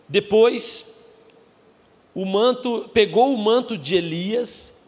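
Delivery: high, loud slow preaching. Acted. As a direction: proclaim the scripture. Preaching scripture at full force.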